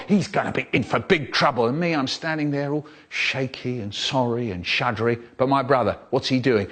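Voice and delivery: normal talking voice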